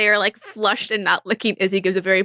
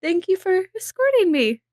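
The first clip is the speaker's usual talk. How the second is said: much higher, breathless ladylike thanks; flustered Izzy; 4 semitones higher than usual. Acted. Izzy to Benny, after the check-up, high flustered thanks. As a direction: thank him sweetly, flustered.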